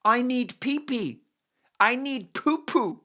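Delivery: put-on high, slow babyish little kid; mock baby talk; toddler voice. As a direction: whine it like a potty-training toddler. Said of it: "they just sort of say".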